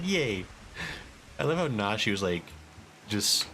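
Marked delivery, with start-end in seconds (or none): 0.0-0.5 chirpy yay. Retold or imitated. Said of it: "Nashu in the back is like".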